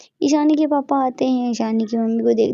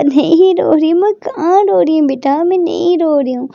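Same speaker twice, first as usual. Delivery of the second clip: laughing through tears; 4 semitones higher, 5 dB louder than usual. Acted. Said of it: "आंसू पूछती हुई बोलती है".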